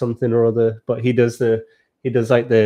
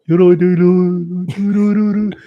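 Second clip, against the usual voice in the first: high, slow mumbling Brummie drawl; 7 semitones higher, 3 dB louder than usual. Retold or imitated. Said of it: "speak like [name]".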